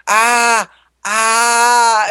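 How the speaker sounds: high, drawn-out "aah"; mock pained cry. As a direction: imitate a victim lying there wailing. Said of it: "He's like".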